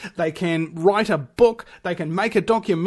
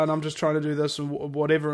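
low, softer imitation of a modest guest; mock bashful; 6 semitones lower, 6 dB quieter, faster than usual. Radio host imitating guests who call themselves ordinary, bashful. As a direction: play down yourself, quick and low. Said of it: "real bashful about it".

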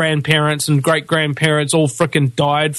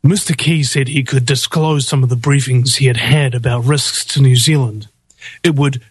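lowered voice, reading for radio; put-on deeper newsreading voice. Shown as a demonstration. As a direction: drop the pitch and read it like radio. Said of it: "a deeper voice is a much better".

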